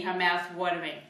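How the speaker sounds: usual speaking voice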